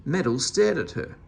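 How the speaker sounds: plain reading voice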